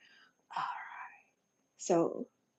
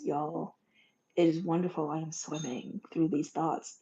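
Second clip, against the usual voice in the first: normal talking voice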